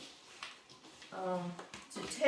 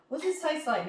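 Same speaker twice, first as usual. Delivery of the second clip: tag only: imitating husband; 8 semitones higher, 3 dB louder than usual. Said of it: "husband asking about the dish".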